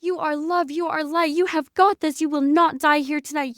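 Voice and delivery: high, loud, fervent commands; screamed prayer. Retold or imitated. Screaming prayer over the seizing man, loud fervent commands. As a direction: pray over him like a command. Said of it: "I'm screaming prayer at this man".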